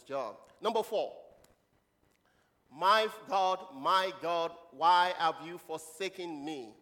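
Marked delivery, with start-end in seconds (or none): 2.7-6.7 loud, slow declaimed cry; scripture proclamation. Acted. Preacher voices Jesus' words to God, proclaimed. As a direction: stretch each phrase and project it.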